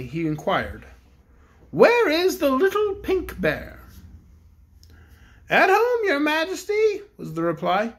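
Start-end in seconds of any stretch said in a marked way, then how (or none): 1.7-3.8 a higher, grand royal voice; the Bear King addresses the cook; kindly Bear King. Acted. King asks. 5.5-7.0 high little bear voice; toy bear. Acted. A bear replies.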